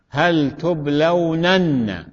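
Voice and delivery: تبلونن pronounced very slowly, every nun sounded; drawn-out demonstration. Shown as a demonstration. Teacher adding emphasis to the verb, drawn-out demonstration. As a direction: spell out تبلونن by ear, stretched slowly.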